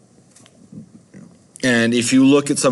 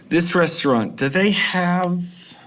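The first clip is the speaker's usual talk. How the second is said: put-on indecisive kid; 9 semitones higher, slower than usual. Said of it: "the kid is very indecisive".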